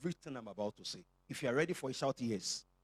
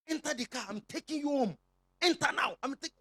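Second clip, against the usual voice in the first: mock stern order; 10 semitones higher, 5 dB louder than usual. Pastor mock-ordering a member home.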